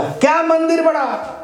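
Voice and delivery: put-on high, insistent drunk arguing back; acted drunkard scoring a point; drunkard's retort. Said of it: "एक शराबी शराब पी लिया था".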